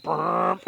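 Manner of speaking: a quick lip-buzzed motor roar; imitated boat engine. Telling family how the rival boat sped off, a motor buzz. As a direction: make the outboard take off, brrrm. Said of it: "Captain Bill's like".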